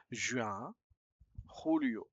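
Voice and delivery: usual speaking voice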